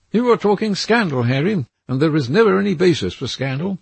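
put-on a nervous young man seeing a threat in the trees; frightened Dorian. A narrator's reading.

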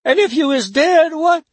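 frightened cry. A narrator's reading. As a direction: cry out in alarm. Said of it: "she cried".

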